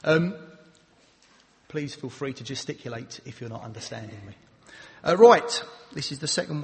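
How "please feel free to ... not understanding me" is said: much softer and lower, trailing away; too quiet. Shown as a demonstration. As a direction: mumble it quietly to show the problem. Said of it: "speaking a bit too quietly".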